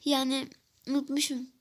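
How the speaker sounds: normal talking voice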